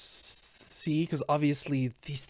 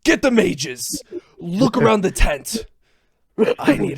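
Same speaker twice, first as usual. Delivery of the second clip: guard shouting orders, high and very loud; urgent shout; 9 semitones higher, 13 dB louder than usual. Acted. Guard sending others to search the tent, shouting urgently. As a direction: bellow urgent commands to the others. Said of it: "as he shouts".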